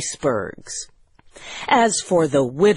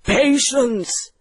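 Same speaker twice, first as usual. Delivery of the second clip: higher-pitched character voice; Doctor Heidegger; 7 semitones higher than usual. Acted. Doctor to his impatient guests, higher character voice. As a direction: call for patience in a put-on voice. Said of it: "said Doctor Heidegger".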